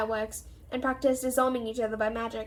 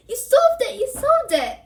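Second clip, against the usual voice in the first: high, loud joyful shout; excited scream; 11 semitones higher, 8 dB louder than usual. Acted. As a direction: Hermione screaming with joy.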